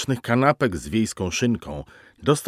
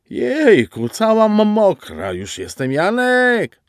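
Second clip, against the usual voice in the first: much higher, breathless whimpering voice; whiny moaning; 11 semitones higher, 5 dB louder, slower than usual. A narrator's reading. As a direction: coo and whine from under the sink. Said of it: "jękliwe odgłosy".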